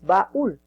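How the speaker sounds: baúl split, with the force on final úl; ba, then a stressed úl. Shown as a demonstration. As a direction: lean on the second syllable of baúl. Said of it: "la mayor fuerza de voz es en ul".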